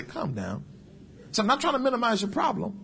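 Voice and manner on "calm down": low, soft and unruffled; calm reply. Retold or imitated. Preacher answering excited callers, calm and quiet. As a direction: quietly settle an excited person. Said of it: "they be all excited. That's the first thing I say".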